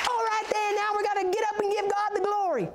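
very high, excited rallying cry; jubilant preacher. Retold or imitated. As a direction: imitate a revival preacher rousing someone. Imitates jubilant preacher urging her up.

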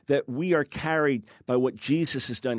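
ordinary delivery

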